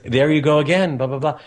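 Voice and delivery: mock the mother's fed-up scolding; exasperated mother. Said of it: "She says".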